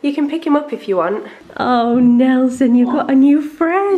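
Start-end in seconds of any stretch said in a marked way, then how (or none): 1.6-4.0 pet-talk. Real, not acted. Greeting her cat Nelson.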